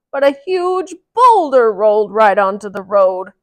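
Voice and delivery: loud, urgent retelling; shaken wagon driver. Acted. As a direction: tell it breathless from the road. Wagon driver tells story.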